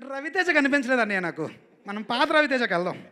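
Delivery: loud, earnest notes to the star; director's complaint. Retold or imitated. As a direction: coax the hero back. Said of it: "బాగా imitate చేస్తావు". (Director హీరోకి సూచన ఉత్సాహంగా)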